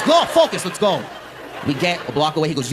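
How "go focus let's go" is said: high urgent mock dad; dad hurrying his son, high; dad's voice. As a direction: push him out the door, pitched up.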